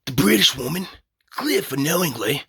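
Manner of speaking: mock British, testing it out; British accent attempt. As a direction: try out a shaky-quality British accent. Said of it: "is this a good British voice?".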